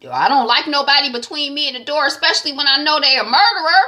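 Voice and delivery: rising mock alarm at being cornered; worried outburst. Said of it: "I was like".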